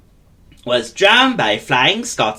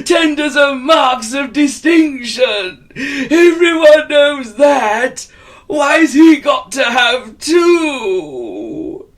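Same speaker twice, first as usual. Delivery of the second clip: much higher, louder jealous wail; 13 semitones higher, 5 dB louder, slower than usual. Acted. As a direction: shout out your envy. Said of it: "He was rather jealous".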